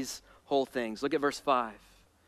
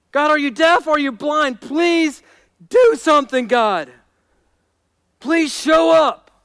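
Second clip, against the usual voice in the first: loud, high, desperate demand; raw, bold plea; 12 semitones higher, 10 dB louder than usual. Acted. David begging God to act before he dies, raw and bold. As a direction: cry out to God, hammering each question. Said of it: "ferociously bold request for God to move".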